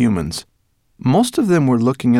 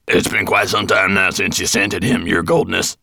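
louder dwarf voice, deferential; dwarf servant; 5 dB louder than usual. A narrator's reading. Dwarf to dragon master about the lost scent, deferential. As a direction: play the dwarf reminding his master.

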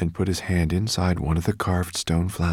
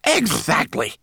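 high, loud burst of agreement; relieved cry; 11 semitones higher, 5 dB louder, slower than usual. A narrator's reading. Homunculus is backed up by boy, relieved cry. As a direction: seize on it with relief. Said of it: "cried the homunculus in relief".

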